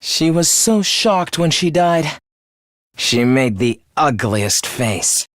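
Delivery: low-pitched, chilling murder confession; dark, low gloating; cold gloating. Acted. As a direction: recount the kill in a low, cold voice. Killer gloats over the murder.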